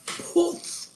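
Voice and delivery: airy, high puff of a spell; sudden "poof" sound effect; magic poof. Acted.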